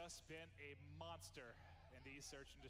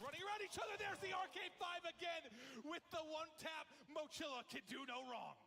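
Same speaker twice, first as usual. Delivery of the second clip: higher, louder, fast hype; excited call; 10 semitones higher, 6 dB louder than usual. Real, not acted. Caster calling the clutch kill, fast excited. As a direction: rush the call as the kill lands.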